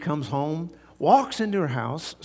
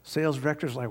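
ordinary talk

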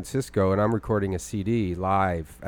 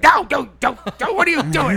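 put-on high, loud, protesting old lady; upset grandma; 7 dB louder than usual. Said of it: "she was like".

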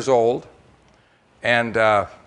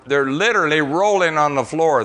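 ordinary delivery

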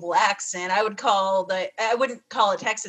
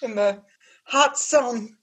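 fake Louisville accent; 3 semitones higher than usual. Guest joining the Louisville mockery, mild fake Southern accent. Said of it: "put on, like, a New Orleans accent".

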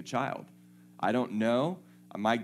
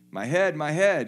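high, loud cry, my head; a sick child crying out, pitched up and loud; boy's cry of pain; 8 semitones higher, 11 dB louder than usual. Acted. Boy crying out to his father in pain.

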